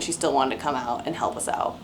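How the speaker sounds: normal talking voice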